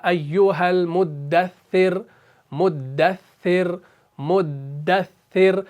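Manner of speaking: مدثر stretched slowly, the dal doubled against the upper gums; slow tajweed drill. Shown as a demonstration. Teacher drilling students on مدثر, very slow. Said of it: "دال کا مخرج".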